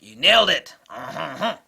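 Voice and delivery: a very loud burst of "you nailed it"; a loud, delighted exclamation; excited agreement. Retold or imitated. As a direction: cheer it like he scored. Quoting the Frenchman agreeing.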